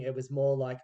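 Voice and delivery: ordinary talk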